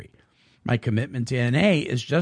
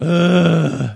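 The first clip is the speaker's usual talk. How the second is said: exasperated groan; 9 dB louder than usual. Retold or imitated. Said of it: "he'll look at me and go".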